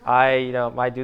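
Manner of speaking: lightly put-on Miami accent, a touch soft; mock his own awkward Miami accent on camera; a hint of a cheesy Miami accent; horrible Miami accent. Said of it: "this horrible Miami accent".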